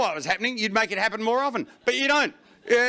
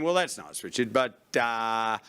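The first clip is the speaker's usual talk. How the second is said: low, quiet skeptical punter; low skeptical audience member; 7 semitones lower, 3 dB quieter than usual. Retold or imitated. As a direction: mutter it like a knowing heckler. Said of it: "You're going".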